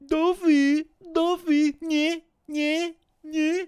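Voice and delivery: very high, loud squeaky mimicry; mock his annoying noise in a piercing high voice; imitating a verbal tic. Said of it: "he's always like".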